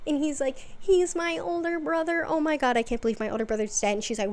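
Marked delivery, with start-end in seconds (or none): 0.8-3.9 higher pitched, speeding up into panic; mock freaking out. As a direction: act out a brother's frantic shock. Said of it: "He's freaking out".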